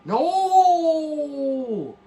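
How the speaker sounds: long, high, loud "no"; drawn-out shout of protest; mock anguished cry. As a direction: wail it out in exaggerated dismay. Mock despair at a chat message.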